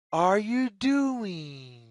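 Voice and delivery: ordinary delivery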